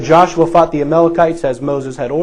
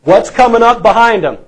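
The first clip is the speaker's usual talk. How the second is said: higher, louder challenge to the congregation; louder repeated question; 6 semitones higher, 6 dB louder than usual. Real, not acted. Preacher quizzing his congregation, louder. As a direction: push the question out to the room.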